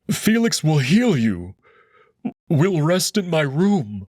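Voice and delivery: grieving wolf pleading with his dying love; Amicus, tearful hope. Acted. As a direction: hold back tears while insisting he'll live. Amicus promises dying Marco a future.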